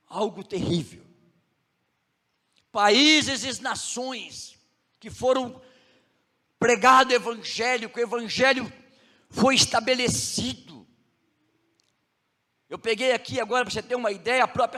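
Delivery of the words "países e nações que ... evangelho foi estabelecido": pregação forte e mais alta, bem lenta; preaching. Acted. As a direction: proclame como do púlpito. Preacher raises to full force. (pregação enfática, alta e lenta)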